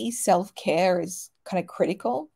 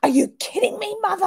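much higher and louder put-on squeal of disbelief; shocked daughter; 14 semitones higher, 6 dB louder than usual. Daughter learning mum moonbathes crystals, squealing disbelief. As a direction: overdo the teen outrage. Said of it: "She's like".

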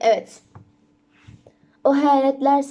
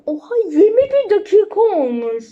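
surprised laugh; 6 semitones higher, 5 dB louder than usual. Real, not acted. Surprised at video length.

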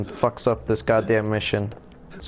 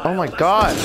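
exasperated shout; 7 semitones higher, 4 dB louder than usual. Real, not acted. Getting attacked in game.

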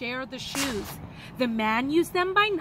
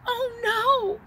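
dismayed cry; 9 semitones higher, 3 dB louder than usual. A narrator's reading. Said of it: "looked like he was going to cry".

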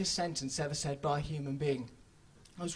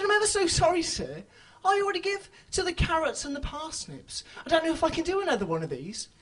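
high, ever-so-polite apology; raised, prim apologetic voice; mock polite refusal; 7 semitones higher than usual. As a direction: fluster out a too-polite no. Overly polite refusal to charity pea.

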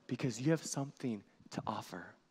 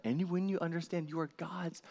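normal talking voice

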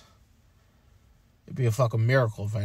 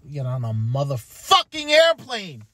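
louder, slower frustrated burst; frustrated outburst; 3 semitones higher, 7 dB louder than usual. Real, not acted. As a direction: spit it out in self-disgust. Frustrated with himself.

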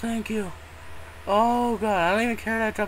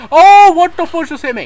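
much higher, loud alarmed yell; jolted by a surprise hit; real shock blurted out mid-sentence; startled shout; 10 semitones higher, 13 dB louder than usual. Real, not acted. Suddenly attacked in game.

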